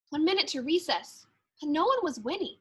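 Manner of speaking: usual speaking voice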